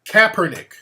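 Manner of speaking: Kaepernick as "CAP-er-nick"; corrected emphasis. Shown as a demonstration. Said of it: "I put the emphasis on the wrong".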